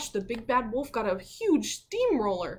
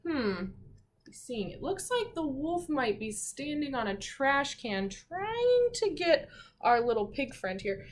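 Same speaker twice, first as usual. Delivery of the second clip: ordinary delivery, nothing marked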